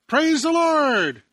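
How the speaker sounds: loud, high, drawn-out joyful cry; joyful shout. Acted. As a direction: shout it out to the whole street with a big smile. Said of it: "she'd just shout out with a big smile".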